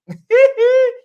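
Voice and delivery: a high, loud squeal of laughter; shrieking laugh. Real, not acted. Host reading a comment, loud shrieking laugh. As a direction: burst into a shrieking cackle.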